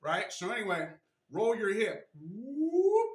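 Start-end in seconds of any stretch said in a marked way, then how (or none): 2.1-3.1 a high, louder whoop as the hip rolls; playful whoop. Acted. Showing viewers the hip roll, a playful high whoop. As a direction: let out a bright whoop of movement.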